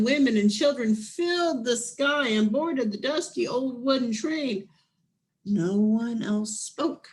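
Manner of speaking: ordinary delivery, nothing marked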